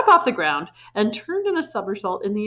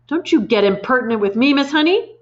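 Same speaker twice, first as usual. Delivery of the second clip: sharp, loud snap at the teacher; snapped; 5 semitones higher, 5 dB louder than usual. A narrator's reading. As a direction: bite the words off. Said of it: "the Trenchbull snapped".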